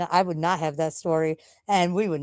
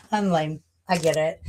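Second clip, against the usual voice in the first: ordinary talk, nothing marked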